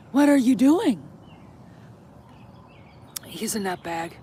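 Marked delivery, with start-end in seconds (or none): 0.1-1.0 raised, louder call to a pet; pet scolding. Real, not acted. Owner catching Koji misbehaving, real scolding. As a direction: pull the dog up with a raised voice. Scolding the dog mid-video.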